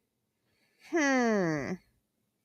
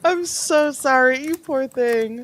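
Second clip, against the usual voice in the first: high, slow, syrupy mock pity; higher and slow fake condolence; mock sympathy; 6 semitones higher, slower than usual. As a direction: coo it sarcastically, drawn out. Mocking sympathy at the video.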